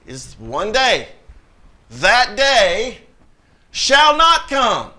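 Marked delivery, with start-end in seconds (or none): 1.9-4.9 louder, higher, drawn-out declaration; emphatic proclamation. Acted. Preacher quoting Paul on that day, emphatic proclamation. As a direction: hammer out each word of the verse, slowly. Preacher hammers home the quoted verse.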